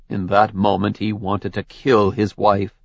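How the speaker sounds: usual speaking voice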